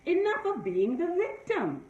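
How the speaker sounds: rising, firm vow to stop being a victim; resolute declaration. Retold or imitated. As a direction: declare it with new resolve, voice lifting. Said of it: "took accountability and said".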